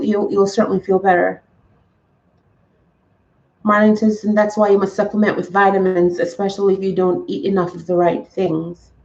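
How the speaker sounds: usual speaking voice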